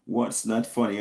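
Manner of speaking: ordinary talk, nothing marked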